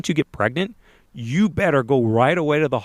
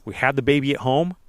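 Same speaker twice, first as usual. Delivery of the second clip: normal talking voice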